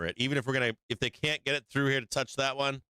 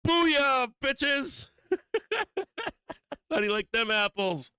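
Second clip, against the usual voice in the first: mock gloating bears; slower than usual. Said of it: "They're just like".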